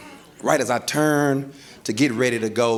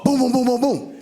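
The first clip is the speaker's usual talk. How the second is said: high, rapid booming knocks; imitated door pounding; 12 semitones higher than usual. Locked-out husband at the apartment door, urgent imitated banging.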